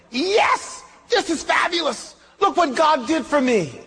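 high delighted mock whoop; hammed-up joy at free money; excited shout. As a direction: crow with glee over a windfall. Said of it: "I was so excited".

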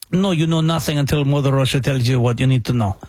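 deep, heavy mock Russian official; mock Russian accent. Host mocking Russian officials, low heavy Russian accent.

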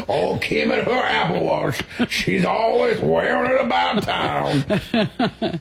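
hammed-up Louisiana old-timer, slowing right down; old Louisiana guy. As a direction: play along as a slow-talking old Louisiana man. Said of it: "ninety-year-old guy from Louisiana".

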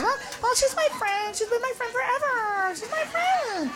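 put-on timid girl defending her friend, softly. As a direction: shrink back and stand up for her softly.

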